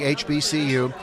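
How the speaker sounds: normal talking voice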